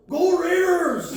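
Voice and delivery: high, slow, loud sports cheer; sudden cheer. Retold or imitated. Mikey cheering at a game on his phone, loud. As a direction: yell it out of nowhere. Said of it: "Mikey just goes".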